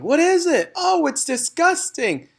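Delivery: high, loud panicked shrieks; children shriek at the newt in alarm; children shouting. A narrator's reading. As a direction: shriek like scared kids seeing a monster.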